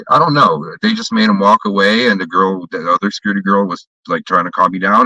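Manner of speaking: normal talking voice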